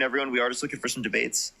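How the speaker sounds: usual speaking voice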